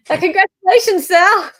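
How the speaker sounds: high, loud cheer of congratulations; big excited congratulations; excited congrats. Real, not acted. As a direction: cheer it out for the newlywed. Cheering a guest's new marriage.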